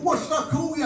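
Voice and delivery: put-on a leery boyfriend squaring up, softly cocky; leery challenge. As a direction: needle the dad with a leery lift. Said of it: "he got all leery".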